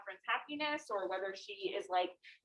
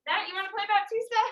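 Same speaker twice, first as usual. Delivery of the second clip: excited; 9 semitones higher, 7 dB louder, faster than usual. Real, not acted. Teacher eagerly calls on student.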